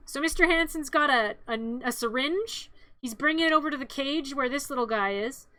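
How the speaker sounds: ordinary delivery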